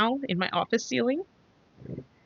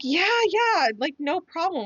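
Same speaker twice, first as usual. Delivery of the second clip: high, delighted mock agreement; giddy yes; 11 semitones higher than usual. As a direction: squeal out a happy yes. Quoting own delighted reply.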